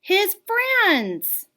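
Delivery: high, loud happy exclaim; excited reveal. A narrator's reading. Narrator reading to kids, excited reveal. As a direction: announce it with delight.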